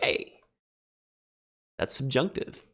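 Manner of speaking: a quiet little aha, lightly voiced; student's realization. Teacher imitating a student recognizing the subjunctive, softly. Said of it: "you'll be like".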